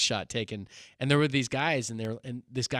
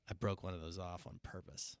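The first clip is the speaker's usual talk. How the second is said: much softer, lower, deadpan; deadpan confession; 7 semitones lower, 18 dB quieter than usual. Retold or imitated. Pastor telling inmate a fake crime, soft deadpan. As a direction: confess it flat and low.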